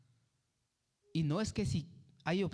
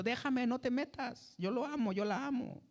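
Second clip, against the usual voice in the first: voz mucho más aguda, caprichosa y suave; terquedad caprichosa; 10 semitones higher than usual. Retold or imitated. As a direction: imita a alguien que no quiere escuchar consejos. Creyente terco rechaza el consejo. (terco que rechaza la palabra, agudo y caprichoso)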